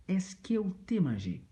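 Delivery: 'Est-ce que ou t'es mangé?' said up high with a falling end; high model repeat. Shown as a demonstration. Teacher repeats the Creole question up high for the class. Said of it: "Just that simple".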